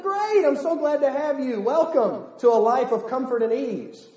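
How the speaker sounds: bright, hammed-up false reassurance; mock cheery Jesus. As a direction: play the too-easy welcome with irony. Sarcastic wrong version of Jesus.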